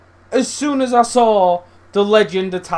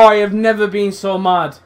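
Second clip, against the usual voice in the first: usual speaking voice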